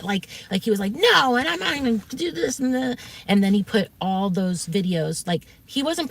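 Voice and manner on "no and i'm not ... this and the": high-pitched imitation of a stubborn refusal; mock his mad insistence, pitched up. Streamer refuses the caller's reassurance, mock mad. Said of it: "when he got really mad".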